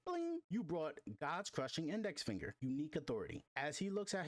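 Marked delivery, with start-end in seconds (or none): 0.0-0.4 high sung chime like a phone alert; ping it out high like a game notification. Acted.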